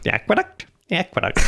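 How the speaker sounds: high-pitched parroting; squeaky mock echo. Host echoes co-host's word for the setting, squeaky. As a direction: pipe the word up high, playfully.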